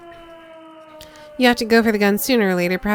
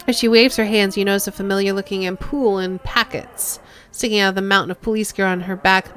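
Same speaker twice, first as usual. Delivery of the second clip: ordinary delivery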